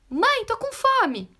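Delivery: high, loud, drawn-out child imitation; mock impulsive yell for mum; shouting child. Hungry small daughter calling her mother, shouting. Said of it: "a criança falou gritando".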